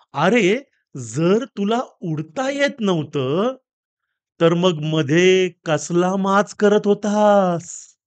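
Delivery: very slow, drawn-out scolding question; parrot's punchline. Acted. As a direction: stretch every word of the punchline. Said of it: "पोपट म्हणतो". (सावकाश, शब्द ताणत पोपटाचा जाब)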